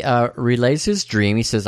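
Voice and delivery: ordinary talk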